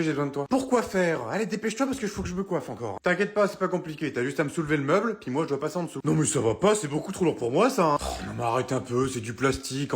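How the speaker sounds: ordinary delivery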